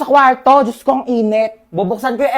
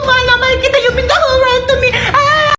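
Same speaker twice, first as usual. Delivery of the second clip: very high, giggly doting squeal; gushing baby talk; 12 semitones higher than usual. Acted. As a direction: smother your baby with high-pitched affection. Gushing over a beloved baby. (matinis at natatawang paglalambing sa baby)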